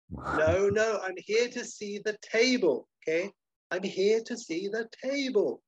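high, slow, deliberate repetition; slow, insistent explaining. Acted. Antique dealer explaining to the waiter, slow high insistence. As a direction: spell it out with strained patience.

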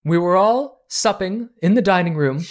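hammed-up grief, rising; distraught lady. Noblewoman grieves for her murdered father to the cousins, high loud wail. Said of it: "continues the lady's voice".

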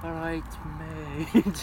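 very slow Wolverhampton greeting; Wolverhampton accent. Retold or imitated. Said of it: "it's a Wolverhampton thing".

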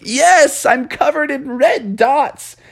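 high wail, slowing down; wailed admission. Acted. Friends on the phone admit red dots, high wail. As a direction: moan it high and drawn out.

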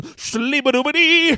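high, slow scat imitation.

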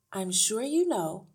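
a hint of pointed courtesy; earnest teacher. A narrator's reading. Miss Honey begins explaining to the parents, earnest.

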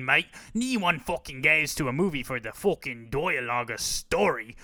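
hammed-up British accent, dismissive; British bloke. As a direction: brush off the critic like a rowdy British fan. Imagined British fan argues back.

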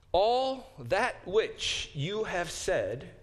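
slow wooden reading. Shown as a demonstration. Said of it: "I've translated that fairly woodenly".

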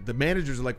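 ordinary talk

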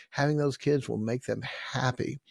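ordinary talk, nothing marked

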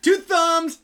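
loud, high catchphrase delivery; punchy show-off call; playful boast. Pretend. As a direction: announce yourself with mock pride. Performs boastful two-thumbs catchphrase.

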